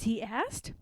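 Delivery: plain reading voice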